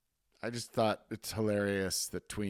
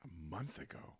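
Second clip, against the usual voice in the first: softer, breathless whispered question; whispered surprise; 5 semitones lower, 15 dB quieter than usual. Real, not acted. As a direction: whisper it, amazed. Surprised at tattoo news.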